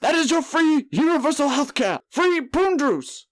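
very high, slow, drawn-out politician voice; hammed-up, off-key campaign proclamation; Bernie Sanders impression. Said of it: "I'd take Bernie's old ass".

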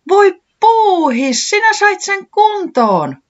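very high, loud, slow cry of joy; bunny overjoyed to see its bike fixed; joyful whoop. A narrator's reading. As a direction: squeal it out with happy tears. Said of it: "hihkaisi säämies".

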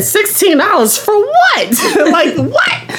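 high, slow outraged question; outraged disbelief. Retold or imitated. Telling friend about the app charge, high outraged disbelief. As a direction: rise up in disbelief over the price.

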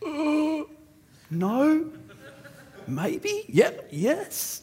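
nervous squeak. Retold or imitated. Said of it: "I was like".

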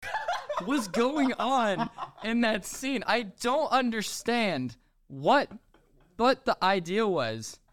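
high-pitched incredulous rant; real comic exasperation; exasperated bafflement. Real, not acted. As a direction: plead for an explanation, stretching the words. Exasperated rant about a baffling scene.

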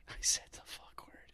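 hushed mock whisper, no voice. Cohost echoing the swear confession in a whisper.

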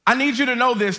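ordinary talk

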